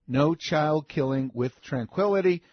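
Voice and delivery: ordinary delivery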